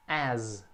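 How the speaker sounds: "As" ending in a long buzzing Z. Shown as a demonstration. Teacher models for students, "As" with a drawn-out Z ending. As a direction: hold the voiced Z that closes "As". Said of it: "tem um som de Z no final".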